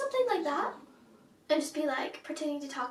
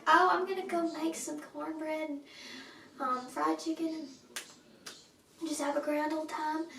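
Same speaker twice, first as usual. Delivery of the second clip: pronounced Southern accent. Shown as a demonstration. Showing off Southern for fun, pronounced Southern accent. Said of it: "very pronounced Southern accent".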